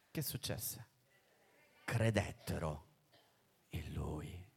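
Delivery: sussurrato, lento; sussurro. A narrator's reading. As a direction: confida il finale in un sussurro. Svelamento solenne. (il predicatore svela il finale, sussurrando)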